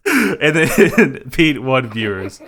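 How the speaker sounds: slow, giggling through the words; can't stop laughing while reading results. Real, not acted.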